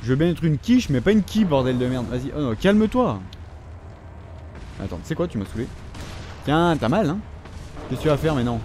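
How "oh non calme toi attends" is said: usual speaking voice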